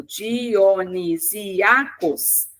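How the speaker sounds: "Dionisiacos" very slowly, split Di-o-ni-sí-a-cos; syllable by syllable. Shown as a demonstration. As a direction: sound out each syllable of "Dionisiacos" separately. Teacher splits word into syllables. ("Dionisiacos" silabado bem devagar, sílaba por sílaba)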